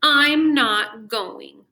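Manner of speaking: slow, loud, dragged-out refusal; a sulky kid digging in her heels; stubborn child. A narrator's reading. Sarah refusing her father from under the covers, loud and slow. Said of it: "said Sarah".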